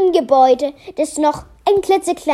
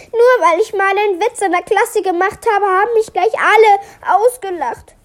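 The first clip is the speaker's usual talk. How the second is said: put-on higher-pitched, aggrieved child voice; child's complaint; 7 semitones higher, faster than usual. A narrator's reading. Melia tells mother she was laughed at, complaining.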